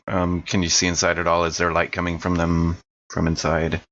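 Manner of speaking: ordinary talk, nothing marked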